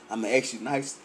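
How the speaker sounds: low, soft, measured warning; asking nicely. Acted. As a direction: keep it quiet and controlled, as a polite warning. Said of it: "I ain't gonna say it like that".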